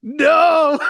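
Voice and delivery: high, loud drawn-out wail; wailed dismay. Retold or imitated. Acting out a heartbroken movie watcher, wailed dismay. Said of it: "And you're like".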